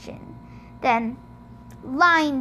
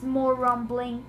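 usual speaking voice

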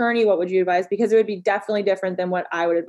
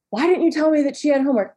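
exasperated parent; 5 semitones higher, faster than usual. Retold or imitated.

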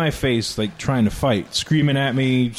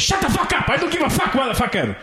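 high mock yell, imitating the angry guy; a shrill retold scream at a comic; 10 semitones higher, faster than usual. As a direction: screech it like a tiny mafia boss. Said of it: "address the screaming".

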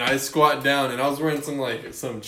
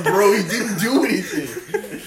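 higher, slow, half-laughing complaint; laughing scoff; 7 semitones higher, 5 dB louder than usual. Retold or imitated. Narrator to the cheering onlookers, a slow laughing scoff. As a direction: roll your eyes at the crowd, chuckling.

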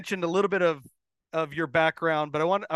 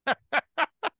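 a real, rising little laugh; teasing laugh; 8 semitones higher than usual. Real, not acted. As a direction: laugh lightly while teasing the guest. Host laughs while teasing about accent.

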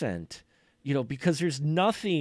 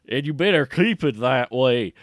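louder mock Southern accent threat; Southern twang; 8 dB louder than usual. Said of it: "like, that twang".

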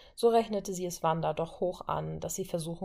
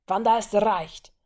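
ordinary delivery

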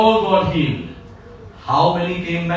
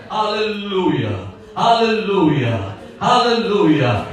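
ordinary talk

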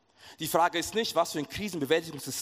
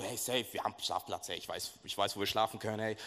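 low, soft, nervous fib; a quiet, low voice covering up a lie; 5 semitones lower, 6 dB quieter than usual. Retold or imitated. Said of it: "ich hab gelogen".

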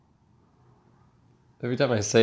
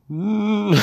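annoyed hum; 10 semitones higher than usual. Acted. Annoyed driver cut off.

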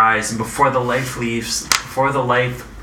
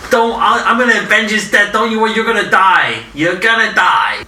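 mobster voice; 8 semitones higher than usual. Acted. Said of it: "sound like a, a mobster?".

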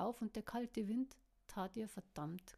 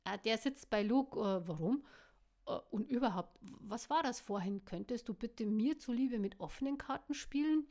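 louder, hurt calling out; M asks Caro to be honest, raised and offended; a bit offended; 2 semitones higher, 7 dB louder than usual. Acted. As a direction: call out, stung and sulky. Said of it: "rief M ein wenig beleidigt".

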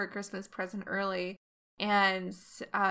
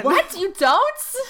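surprised; 9 semitones higher, 12 dB louder, much slower than usual. Real, not acted. Surprised at friend's claim.

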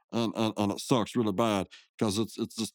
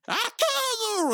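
much higher, slow hammed-up yell; mock battle cry; much slower than usual. Mordecai shoving Rigby into the tunnel, a slow hammed-up yell. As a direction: drag out a cartoon war cry.

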